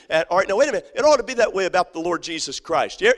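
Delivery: ordinary delivery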